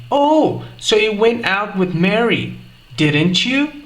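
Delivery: "didn't you?" rising, open question; "Oh" up front, tag lifted on "you"; rising tag intonation. Shown as a demonstration. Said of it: "Vou repetir".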